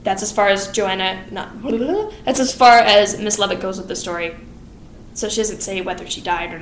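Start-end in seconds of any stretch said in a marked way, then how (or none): none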